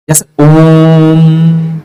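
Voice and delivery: Om held very long and slow; drawn-out Om. Shown as a demonstration. Teacher demonstrates pluta, Om stretched slowly. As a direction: hold the vowel of ओम like a chant. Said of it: "इसका प्रयोग संधि में नहीं होता है".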